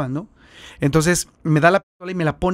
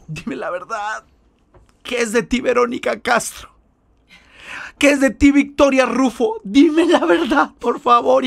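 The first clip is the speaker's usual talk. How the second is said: sobbing plea; 7 semitones higher than usual. Retold or imitated. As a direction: wail the names, begging through sobs. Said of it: "Y empieza a llorar". (súplica entre sollozos, aguda y lenta)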